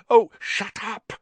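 high, loud panicked outburst; frantic, high-pitched cry. A narrator's reading. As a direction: shut them up in a panic. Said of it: "said Harry frantically".

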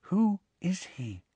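slow, soft, high hushed question; hushed, high and drawn out; frightened whisper. A narrator's reading. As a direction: whisper it slowly and carefully. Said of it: "she said in a whisper barely audible".

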